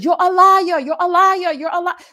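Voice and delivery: high, loud ranting mimicry; hammed-up shouted accusation; mock yelling liar. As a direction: yell it at him like she did. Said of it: "you just started losing your mind".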